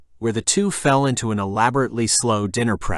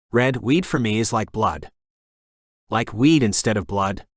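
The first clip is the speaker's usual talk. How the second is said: ordinary delivery, nothing marked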